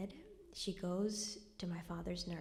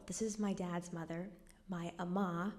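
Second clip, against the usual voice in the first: ordinary talk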